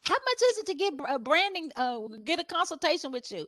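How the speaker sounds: high-pitched caller asking about a headshot; mock caller. Client phoning for a headshot, high mock caller voice. Client calling asks price.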